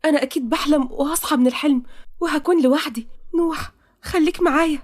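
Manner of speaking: high-pitched, disbelieving heroine; overwhelmed young heroine. Acted. Heroine thinks her reunion is a dream, overwhelmed.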